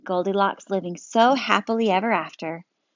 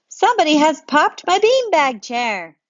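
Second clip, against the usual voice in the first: put-on much higher, louder child; a kid wailing about his beanbag; high loud tattling kid; upset child; 8 semitones higher, 6 dB louder than usual. Child voiced in story.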